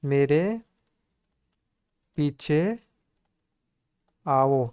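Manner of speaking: slow, with a long pause between words; word-by-word dictation. Shown as a demonstration. Teacher dictating a Hindi answer to students, spaced out. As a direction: spell the sentence out word by word. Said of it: "यहाँ पे क्या हो जाएगा दोस्तों?".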